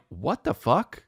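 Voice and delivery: ordinary talk, nothing marked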